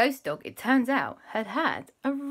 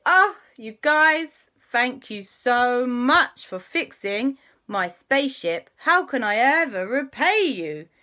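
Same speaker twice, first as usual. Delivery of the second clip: grateful thanks; 5 semitones higher, 5 dB louder, slower than usual. Acted. Trixie thanks them.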